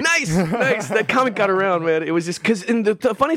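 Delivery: very high, excited exclaim. Real, not acted. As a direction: cheer it out, delighted. Excited at shared comic.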